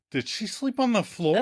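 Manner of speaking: high, incredulous question; baffled question. Retold or imitated. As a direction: ask in mock disbelief. Host re-enacts own puzzled reaction.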